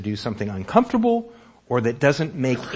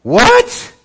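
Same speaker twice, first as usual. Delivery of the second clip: high, loud, incredulous "What?"; shocked disbelief; 12 dB louder than usual. Acted. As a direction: cry out in total disbelief. Jack hears the shocking order.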